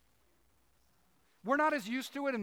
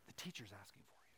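secret hushed whisper; 13 semitones lower, 21 dB quieter, faster than usual. Acted. Sister whispering the news to Mary. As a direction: breathe it to her so no one hears. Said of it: "quietly whispers to, to Mary".

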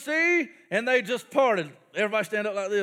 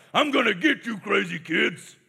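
mock villain Pharaoh; 3 semitones lower than usual. Pharaoh voiced chasing the Israelites.

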